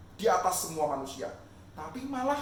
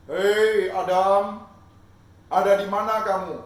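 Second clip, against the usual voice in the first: loud, slow booming call; 15 dB louder, slower than usual. Acted. God calling for the hiding Adam, slow loud call. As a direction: call across the garden, long and loud.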